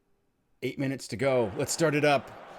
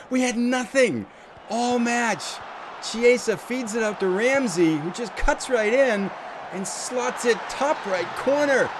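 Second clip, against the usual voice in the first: thrilled disbelief; 8 semitones higher than usual. Real, not acted. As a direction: relive the goal breathlessly. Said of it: "Sorry if I'm getting excited".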